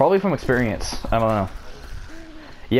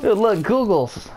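high, drawn-out delight; playful excitement; 8 semitones higher, slower than usual. Real, not acted. Player shows teammate the goggles, high playful excitement. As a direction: show off the find, high and slow.

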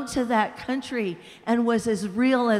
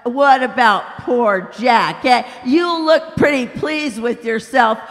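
big ghost, indignant; 3 semitones higher, 8 dB louder than usual. Acted. Big ghost shouting at the bright spirit, loud and outraged. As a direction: bellow it like an aggrieved bully.

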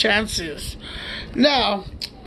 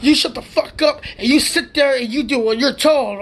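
cop snapping; 4 semitones higher than usual. Retold or imitated. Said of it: "you pulling everybody".

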